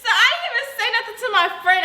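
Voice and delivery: quick words through a laugh; rushed and giggling. Real, not acted. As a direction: still cracking up. Laughing after her own laugh.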